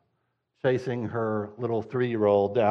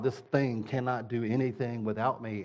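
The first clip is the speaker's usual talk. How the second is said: usual speaking voice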